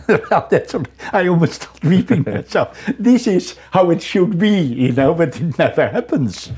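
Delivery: real laughter, half moved; laughing. Real, not acted. Laughs while recalling weeping.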